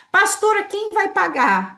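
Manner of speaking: high, loud anxious plea; worried believer. Retold or imitated. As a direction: whine it like a worried congregant. Imitates a worried church member.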